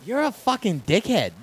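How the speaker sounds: badly faked Philly that slides toward Australian; bad Philly accent. Shown as a demonstration. Co-host tries out the accent, badly faked Philly. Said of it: "I don't have a good Philly".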